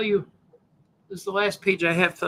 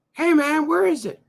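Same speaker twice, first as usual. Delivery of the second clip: heckling voice; 9 semitones higher, 4 dB louder than usual. Acted. Heckler voice calls out to host.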